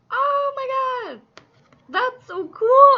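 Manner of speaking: high, loud, thrilled outburst; excited squeal. Real, not acted. Tarot reader thrilled at the card spread, excited squeal. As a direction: burst out with real delight at the cards. Reading reveals a wished-for card.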